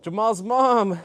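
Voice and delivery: loud, high call of a name; loud shout-out. Real, not acted. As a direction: call out the name across the room. Shout-out to chat.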